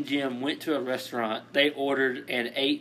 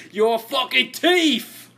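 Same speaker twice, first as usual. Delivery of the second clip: slow, higher, laughing Tommy Wiseau voice; Tommy Wiseau impression; 10 semitones higher, 6 dB louder, slower than usual. Friend guesses his voice, slow Tommy Wiseau style. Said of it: "Tommy Wiseau?".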